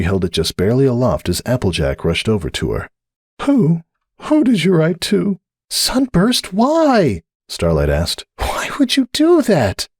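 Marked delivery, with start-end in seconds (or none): 3.4-5.4 urgent mare voice. A narrator's reading. Cupcake urgent. 5.7-7.2 puzzled mare. A narrator's reading. Starlight asks. 8.4-9.9 dismayed question. A narrator's reading. Starlight dismayed.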